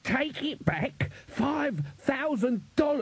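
very high, drawn-out squawk, ending muffled; outraged outburst. A narrator's reading. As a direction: protest in a high voice until gagged. Gagged dwarf's outburst, hand returns.

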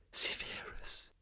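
slow, voiceless whisper of a name. A narrator's reading. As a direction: breathe it out as a plea. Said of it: "flüsterte sie".

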